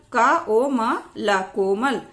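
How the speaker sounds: slow, syllable by syllable; slow spelling-out. Shown as a demonstration. Teacher spelling an example for learners, slow spelling-out. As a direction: sound out each part, then the word.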